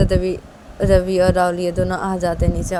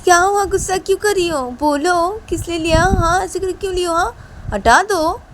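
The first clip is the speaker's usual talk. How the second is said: much higher, louder taunting; teasing; 11 semitones higher, 5 dB louder than usual. Acted. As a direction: needle her. Shruti teases angry Shreya.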